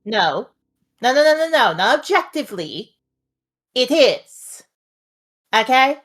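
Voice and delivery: emphatic in-character objection; firm insistence. Acted. Ray refusing to let a friend downplay it, firm insistence. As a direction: cut in and insist, voice lifting. Acted insistence against player's hedging.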